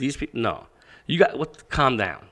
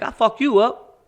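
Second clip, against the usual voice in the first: retold threat; 9 semitones higher, 5 dB louder than usual. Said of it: "I'm like".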